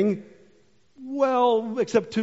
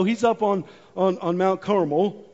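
normal talking voice

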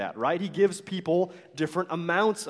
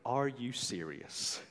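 low, quiet disbelief; mock-offended, dropping low and soft; frustrated; 5 semitones lower, 10 dB quieter than usual. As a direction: say it under your voice, as if appalled. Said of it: "It's frustrating".

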